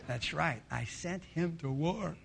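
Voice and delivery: low, slow, soft self-congratulation; smug gloating. Acted. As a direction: savor it slowly and slyly. King gloats over absent husband.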